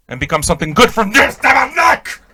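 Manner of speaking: rising to a high shouted tabarnak; mock shout. Comic nun vowing redemption, then shouting a high curse.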